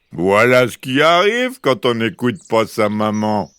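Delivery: lightly voiced teasing papa bear; storybook big bear, amused; big bear mocking. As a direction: chuckle it at the fleeing girl. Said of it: "se moqua-t-il de sa grande voix".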